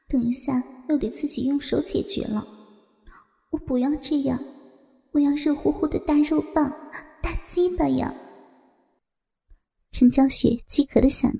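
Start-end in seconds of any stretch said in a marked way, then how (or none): 0.1-8.1 hushed, yearning private thought; inner thought. Acted. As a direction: murmur it to herself. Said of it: "心里想着".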